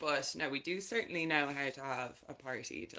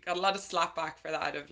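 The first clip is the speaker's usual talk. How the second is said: usual speaking voice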